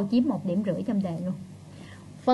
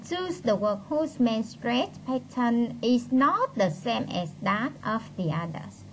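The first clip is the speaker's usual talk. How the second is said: slow, deliberate English read-out; reading instructions aloud; 2 semitones higher, slower than usual. Real, not acted. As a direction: read the task heading out word by word. Reads exam instruction aloud.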